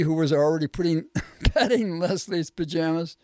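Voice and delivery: ordinary delivery, nothing marked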